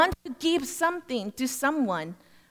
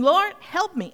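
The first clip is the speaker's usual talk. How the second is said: high, louder plea to God; urgent prayer; 7 semitones higher, 5 dB louder than usual. Retold or imitated. Missionary praying for help, high urgent plea. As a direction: cry out for help. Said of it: "I remember thinking".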